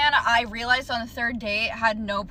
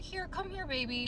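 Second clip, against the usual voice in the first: hushed imitation of a clingy boyfriend, softer; mock soft coaxing; 6 semitones higher, 10 dB quieter than usual. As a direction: murmur it like a pushy lover, mockingly. Retells boyfriend coaxing her.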